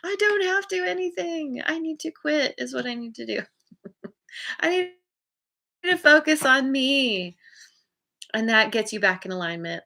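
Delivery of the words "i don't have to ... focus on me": high, whiny pushback; imitating someone fed up, pitched way up; mock exasperated. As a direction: play the cornered person snapping back, high. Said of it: "And you're like".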